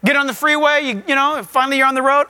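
normal talking voice

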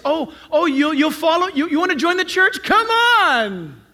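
high, eager recruiter act; over-eager welcome. Acted. Tempted church member welcomes a would-be joiner, over-eager. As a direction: stammer it out eagerly, then stretch the invitation. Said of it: "we would be tempted like this".

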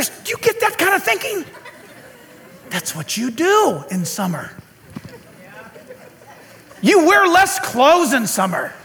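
normal talking voice

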